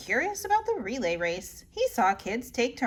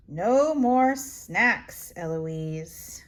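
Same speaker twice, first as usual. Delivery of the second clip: slow stern; 5 semitones lower, much slower than usual. Acted. Nanny scolds slowly.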